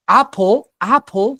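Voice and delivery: "Apple, apple" opened loudly on the vowel; vowel onset. Shown as a demonstration. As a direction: lean on the starting vowel of "Apple, apple". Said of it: "tiene un sonido como una vocal". ("Apple, apple" con sonido inicial de vocal marcado)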